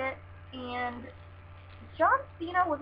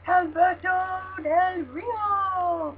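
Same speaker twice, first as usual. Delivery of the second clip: high, drawn-out announcer call of the name; ring announcer; 8 semitones higher, 4 dB louder, much slower than usual. Pretend. Vlogger announcing the winner ringside style, high and slow.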